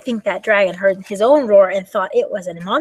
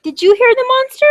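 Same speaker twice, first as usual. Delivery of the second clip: high, loud, urgent question; high-pitched storybook alarm; alarmed little dragon; 7 dB louder than usual. Acted. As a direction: ask in a play panic, pitch shooting up. Dragon alarmed, asks everyone.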